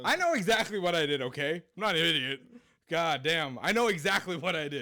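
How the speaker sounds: defensive insistence. Real, not acted. Friend defending himself after being teased, indignant. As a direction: push back hard, exasperated. Insists slurred words were on purpose.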